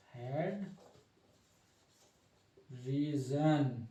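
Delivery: Head, long pause, then drawn-out reason; slow dictation. Real, not acted. Teacher writing the head label for students, slow dictation. Spells out term while writing.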